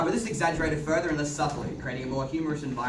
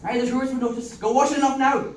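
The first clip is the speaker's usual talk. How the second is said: a lively, louder Irish brogue; Irish accent; 9 semitones higher, 6 dB louder than usual. Acted. Man in Irish accent fussing over a bloodied girl. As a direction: play it broad and rural Irish.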